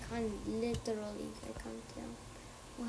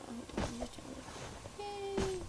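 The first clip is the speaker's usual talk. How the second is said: normal talking voice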